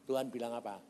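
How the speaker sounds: ordinary delivery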